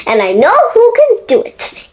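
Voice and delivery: high, fast and eager; excited. Acted. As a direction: blurt it out eagerly. Mister Small excited.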